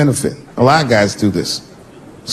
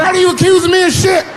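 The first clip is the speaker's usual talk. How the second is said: indignant shout. Retold or imitated. Husband defending himself to wife, high indignant shout. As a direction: yell it, deeply offended.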